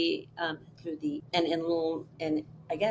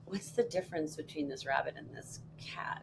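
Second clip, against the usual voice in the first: a soft, unvoiced whisper; whispered thought; 8 dB quieter than usual. Remembering the moment at dinner with dad, whispered. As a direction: whisper it like a thought in your head. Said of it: "I was like".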